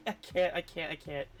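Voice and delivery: ordinary delivery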